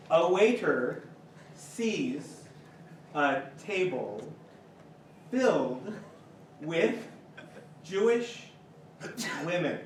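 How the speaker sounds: slow and low, word by word; long suspenseful pauses between words; deliberate joke setup. Real, not acted. Joke teller introducing waiter and table, halting and deliberate.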